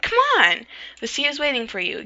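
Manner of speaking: high, loud eager cheer; excited. Acted. Friend pushing Candace toward the sea, high excited cheer. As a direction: egg her on with glee.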